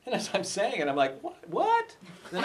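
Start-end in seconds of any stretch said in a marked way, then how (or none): 1.2-2.0 a sharp, much higher double "what"; suddenly catching yourself, baffled; startled. Retold or imitated. Inner voice startled by the name.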